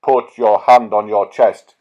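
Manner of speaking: loud, booming voice of God; God commanding Moses, loud and solemn. Acted. God commanding Moses from the holy ground, booming. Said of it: "he commanded".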